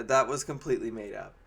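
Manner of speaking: ordinary talk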